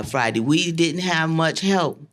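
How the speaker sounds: ordinary talk, nothing marked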